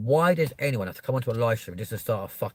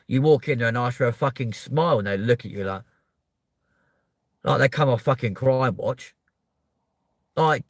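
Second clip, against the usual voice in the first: ordinary delivery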